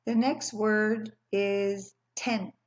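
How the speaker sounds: slow, even "The next word is tent."; slow word prompt. Shown as a demonstration. Teacher giving young kids a word, slowly. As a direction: space out each word for the class. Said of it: "What does tent begin with".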